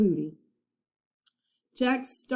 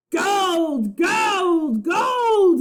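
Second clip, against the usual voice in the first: building loud, higher cries of gold; gleeful cries; 11 semitones higher, 7 dB louder, much slower than usual. A narrator's reading. Captain Bones crying out over the gold, building gleeful shouts. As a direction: cry out in greedy glee. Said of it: "he cried".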